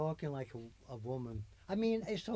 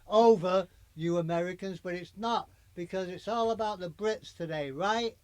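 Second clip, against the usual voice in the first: loud; 8 dB louder than usual. Pretend. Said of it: "talk loud and".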